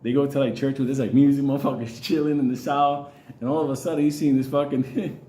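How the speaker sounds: normal talking voice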